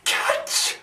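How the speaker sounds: breathy whispered punchline; stage whisper. Acted. Madman delivering his joke's punchline to the doctor, whispered. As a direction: whisper it high, savouring the punchline. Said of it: "he whispers".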